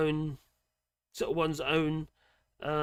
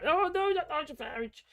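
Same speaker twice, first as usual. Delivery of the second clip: mock horror; 12 semitones higher than usual. Mock horror at own comparison.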